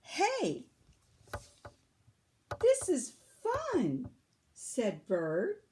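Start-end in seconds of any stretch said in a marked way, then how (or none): 0.0-4.1 much higher, loud, slow delight. A narrator's reading. Bird finding jumping fun, much higher and delighted. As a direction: beam it out, grumpiness gone.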